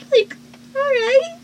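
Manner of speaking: high, squeaky all right; squeaky disbelief. Real, not acted. Reaction to a joke.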